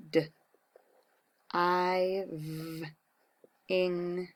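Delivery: "D, I, v, ing" split sound by sound; sounding out words. Shown as a demonstration. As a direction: stretch "diving" in parts. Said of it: "my sound power".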